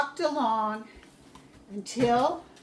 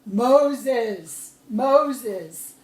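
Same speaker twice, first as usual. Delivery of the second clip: loud divine voice calling a name; God calling from the burning bush, loud; booming call; 9 dB louder than usual. A narrator's reading. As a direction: call out like a voice from the fire. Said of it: "a voice came out of the bush and said".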